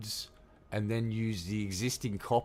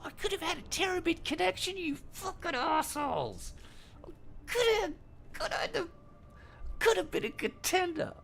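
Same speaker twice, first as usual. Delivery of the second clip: high, squeaky mocking whine, dragging out the words; mock whiny voter. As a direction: ham up a pitiful voter, pitched way up.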